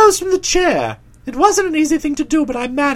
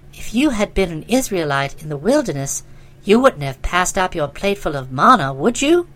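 put-on lower, softened young woman's character voice; Nobby, reproachful young woman; 8 semitones lower than usual. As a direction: chide him in a put-on female voice. Nobby's lines in narrated dialogue.

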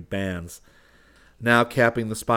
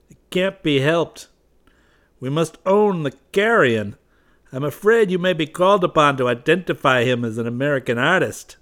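grim resignation; 8 semitones higher, 10 dB louder than usual. Acted. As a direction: say it with a ghost of a smile.

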